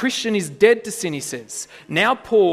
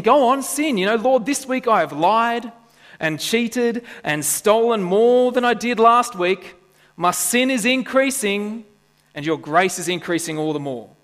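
higher, louder mock prayer of a smug sinner; mocking sinner's prayer; 6 semitones higher, 5 dB louder than usual. Preacher mimics a smug sinner praying to God, mock boastful.

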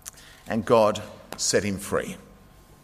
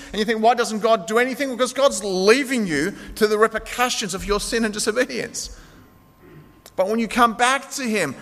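raised, higher preaching voice pressing the point; fervent pulpit delivery, pitched up and pushing; impassioned preaching; 8 semitones higher, 4 dB louder than usual. Acted. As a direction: preach it out to the whole congregation. Preaching at full force.

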